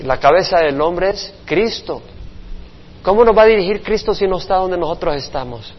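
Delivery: ordinary talk, nothing marked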